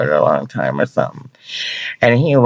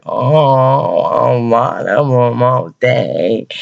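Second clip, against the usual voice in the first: drawn-out, higher gushing voice; gushing retold delight; 3 semitones higher, 3 dB louder, much slower than usual. Retelling a day of hugs from Ducky, slow gushing delight. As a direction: stretch it out, gushing over all the attention.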